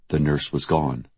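ordinary delivery

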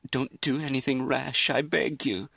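high, distressed begging; tearful pleading. A narrator's reading. Mother begs daughter not to be rash, tearful pleading. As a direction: beg through rising tears. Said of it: "Her mother began to cry".

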